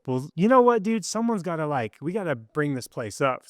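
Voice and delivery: retold rallying cry, pitched well up; rallying the troops. Pepping up a weary detox roommate, rallying. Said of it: "trying to rally the troops".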